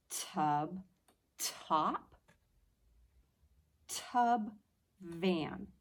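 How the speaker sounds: each word said slowly apart; teacher sounding out word pairs; comparing first sounds. Shown as a demonstration. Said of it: "My mouth did not make the same noise".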